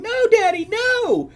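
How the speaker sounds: put-on very high, loud child's shriek; piercing, stretched-out plea; screaming little girl. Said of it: "the little girl is screaming".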